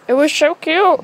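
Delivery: loud, high burst of adoration; gushing. Real, not acted. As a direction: gush loudly over something adorable. Gushing about pet and kittens.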